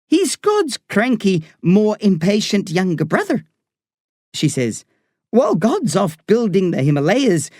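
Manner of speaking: put-on Irish woman doctor talking to a boy patient. A narrator's reading. Doctor voiced in story.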